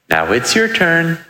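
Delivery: high, slow, drawn-out mock whine; mock crying cue. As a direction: pass on the tears in a high voice. Retelling cue to baby to cry.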